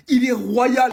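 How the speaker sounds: high, very loud exclamation; admiring shout. Acted. Fan hailing the royal kid, admiring shout. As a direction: belt out the praise at full voice.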